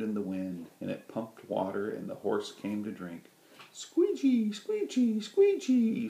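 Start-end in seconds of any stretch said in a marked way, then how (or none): 3.7-6.1 very high, loud squeaking; high creaky squeegee; windmill squeak. A narrator's reading. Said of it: "said the windmill".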